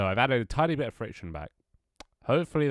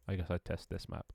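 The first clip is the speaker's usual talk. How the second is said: ordinary talk, nothing marked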